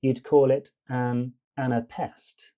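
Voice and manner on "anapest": "anapest" with two light syllables, then a hard PEST; soft, soft, hard. Shown as a demonstration. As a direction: save the punch for the last syllable of "anapest". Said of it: "two soft, then one hard".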